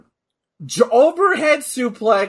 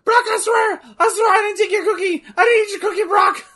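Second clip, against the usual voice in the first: high, fast frantic pleading; nervous bad liar; 13 semitones higher, faster than usual. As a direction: plead your innocence, panicking. Pleading as a guilty cookie thief.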